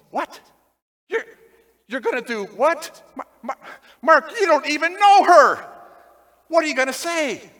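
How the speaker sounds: incredulous wife, rising to a loud burst on her husband's name; startled wife. Retold or imitated. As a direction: play the wife who can't believe what he's about to do. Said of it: "And she goes".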